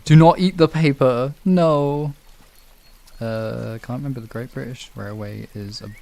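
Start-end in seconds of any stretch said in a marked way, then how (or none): 0.1-2.1 louder firm telling-off; firm no to a pet; scolding the cat. Real, not acted.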